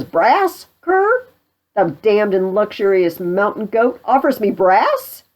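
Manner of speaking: acted indignation. Pistol thinks he's offered brass, loud and indignant. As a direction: take it as a blustering insult.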